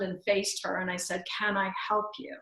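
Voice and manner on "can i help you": much softer, gentle question; calm soft question to a furious stranger; soft, calm offer. Retold or imitated. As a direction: calmly offer help to an angry stranger. Calm offer, repeated softly.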